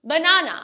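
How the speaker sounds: BANANA with a weak first uh, stressed middle; banana as buh-NAA-nuh, loud and clear; schwa in banana. Shown as a demonstration. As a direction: reduce the first syllable of banana. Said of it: "না, okay?".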